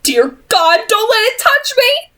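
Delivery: delirious and screaming in fear; terrified cry. A narrator's reading. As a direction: beg in raw terror. Delirious brother screams in terror.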